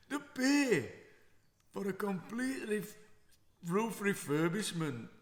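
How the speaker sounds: hammed-up Scottish voice wandering off into an Indian accent; Scottish accent slipping. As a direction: let the Scottish accent slide into an Indian one, with a laugh breaking through. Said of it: "I'm turning into, into an Indian".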